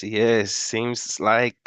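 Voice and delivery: usual speaking voice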